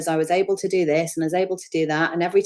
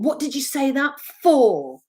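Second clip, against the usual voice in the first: put-on childlike scolding; 7 semitones higher, 3 dB louder than usual. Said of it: "It's very childlike".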